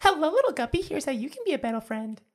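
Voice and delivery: high, sweet cartoon princess voice; sweet fairy tale princess. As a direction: coo it like a storybook princess. Continues princess voice bit.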